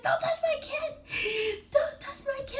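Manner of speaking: very high, slow mock scream; shrieking customer. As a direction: scream to protect the kid.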